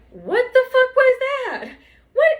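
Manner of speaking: stunned high squeal with a laugh; laughing disbelief. Real, not acted. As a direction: shriek it in disbelief. Incredulous outburst, laugh.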